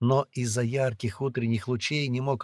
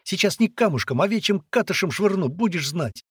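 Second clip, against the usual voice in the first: high, louder scolding; indignant threat; 9 semitones higher, 6 dB louder than usual. A narrator's reading. Girl threatening to pelt the boy, indignant. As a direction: threaten him like a cross girl. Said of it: "возмутилась девчонка".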